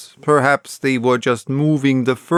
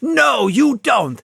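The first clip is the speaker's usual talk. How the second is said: sharp cry; 10 semitones higher than usual. A narrator's reading. The stranger cries out during the struggle, sharply. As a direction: cry out in sudden alarm. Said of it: "a sharp cry of".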